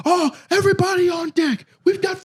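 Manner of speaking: high hammed-up shouting producer, laughing through it; a frantic high-pitched newsroom boss; excited producer. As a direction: yell it like a giddy producer.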